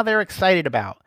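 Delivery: ordinary talk, nothing marked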